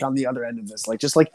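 ordinary delivery

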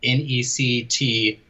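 slow, deliberate spelling; spelled out letter by letter. Shown as a demonstration. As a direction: spell it out slowly, one letter at a time. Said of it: "I will not say the name".

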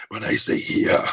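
growly villain with an Austrian-accented "here"; raspy T-Rex voice, "here" ending in an open "ah"; Arnold Schwarzenegger. Shown as a demonstration. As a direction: rasp it low, turning "here" into "hee-ah". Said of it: "like, Arnold Schwarzenegger".

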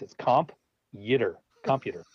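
"comp", pause, "yitter", then "computer" stressed on COM; split syllables. Shown as a demonstration. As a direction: break it into two parts, comp... yitter. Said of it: "break it down into two parts".

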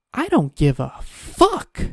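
fed-up retort to a pushy insurance seller; exasperated outburst. Real, not acted. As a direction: let the exasperation spill out slowly. Exasperated retort to sales pitch.

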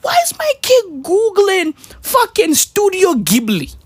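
high, baffled imagined dad; future dad. Pretend. Future dad baffled at his kid's searches, high and puzzled. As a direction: squeak out the confused-parent question. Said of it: "I'm gonna say".

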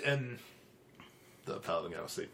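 ordinary talk, nothing marked

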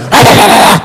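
loud, high stuttered skittering sound; imitated scuttling noise. Showing the spider racing over the bed, loud stuttering noise. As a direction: make a manic noise for a crawling bug.

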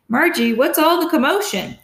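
father calling down to his wife about the noise; calling downstairs. A narrator's reading. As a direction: call down the stairs to your wife. Dad calling down the stairs.

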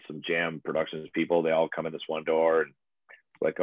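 ordinary delivery

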